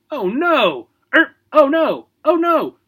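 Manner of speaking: high squawking parrot alarm; screechy bird panic; parrot cry. Acted. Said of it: "cried Mister Smithers".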